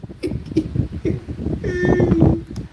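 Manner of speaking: high, squealing laugh; real high-pitched laughter. Real, not acted. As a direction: laugh in a long, high wheeze. Host laughs at an answer.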